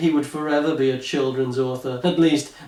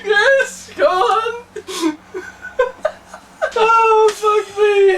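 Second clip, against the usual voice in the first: excited laughing cheer; 5 dB louder, much slower than usual. Real, not acted.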